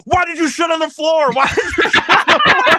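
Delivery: shouting accusation, climbing in pitch; angry yelling. Retold or imitated. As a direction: imitate a guy yelling at you in your face. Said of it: "he's yelling at me while I'm doing it".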